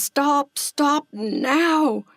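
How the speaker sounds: high, urgent yell to halt; excited storybook yell, pitched high. A narrator's reading. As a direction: call out a sudden stop. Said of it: "and yells".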